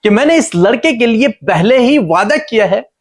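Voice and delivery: high, emphatic, insistent preacher's voice; God reasoning with Hagar. Retold or imitated. God explains His promise to crying Hagar, high insistent reasoning. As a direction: press each question and promise home, pitched high. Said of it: "परमेश्वर उसे समझाने की कोशिश".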